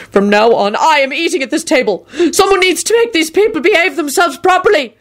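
high, strained angry demand; ominous snarl. A narrator's reading. Angry diner makes demands of his host, snarling. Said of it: "he snarled ominously at Quentin".

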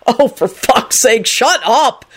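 slow exasperated outburst; angry yell. A narrator's reading. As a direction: yell it fed up, stretching the words. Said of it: "Henry yelled".

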